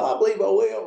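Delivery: high, hammed-up cheerful voice; mock Ed accepting. Ed answering the narrator about the cookie, hammed-up. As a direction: imitate Ed happily agreeing.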